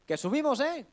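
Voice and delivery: high, loud shout to upstairs; calling up. Acted. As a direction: shout a playful warning up to the hosts. Acts visitors shouting up to hosts. (gritando en alto para avisar)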